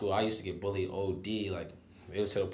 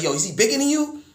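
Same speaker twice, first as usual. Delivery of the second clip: dad voice; 12 semitones higher, 5 dB louder than usual. Retold or imitated. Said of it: "And he's like".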